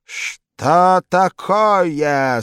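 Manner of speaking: high, loud, very slow menacing question; loud slow threatening boss. A narrator's reading. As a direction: draw it out to freeze him. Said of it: "таким голосом".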